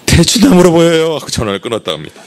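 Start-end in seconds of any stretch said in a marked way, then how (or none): 0.1-1.1 높고 크게 외치듯 대답; 여자의 큰 대답을 재연하듯; 다급한 대답 흉내. Retold or imitated. (높고 큰 목소리)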